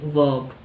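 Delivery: वर्ब said as "vub"; वर्ब with an "uh" vowel and no r. Shown as a demonstration. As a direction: say वर्ब as "vob", r dropped. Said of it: "अ sound आती है".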